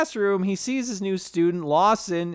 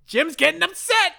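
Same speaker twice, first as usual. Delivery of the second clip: upset high voice; 12 semitones higher than usual. Retold or imitated. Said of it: "He's like".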